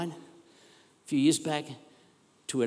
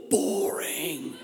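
mocking. Mocks an ordered, predictable life.

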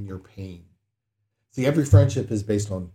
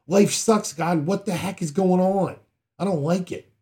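higher and louder blunt complaint to God; lament; 7 semitones higher, 5 dB louder than usual. Retold or imitated. Explaining a lament psalm: a higher, louder complaint to God. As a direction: gripe at God like a fed-up kid. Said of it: "Basically saying".

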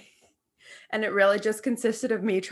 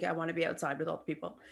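quick and much softer, a whiny dog's plea; dramatic dog voice; 4 semitones lower, 10 dB quieter, faster than usual. Retold or imitated. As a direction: rush it out softly like a pouty pup. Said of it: "He's so dramatic 'cause, like".

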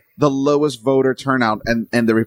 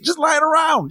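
way higher, incredulous; mock amazement; 13 semitones higher, slower than usual. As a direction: act amazed at the spare money. Mocking states' surplus money.

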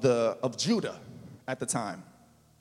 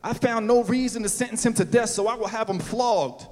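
raised, higher pleading demand; Pilate demanding; 5 semitones higher, 4 dB louder than usual. A narrator's reading. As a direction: push back at the crowd, raised voice. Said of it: "he demanded".

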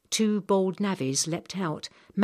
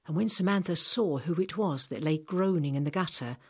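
ordinary delivery, nothing marked

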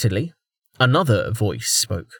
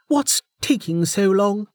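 impatient; 10 semitones higher than usual. Acted. Character dialogue, impatient.

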